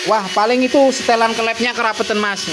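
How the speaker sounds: a hint of a second-guessing commenter; second-guessing viewer. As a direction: lightly imitate someone butting in with a diagnosis. Mimics a know-it-all viewer guessing the fault.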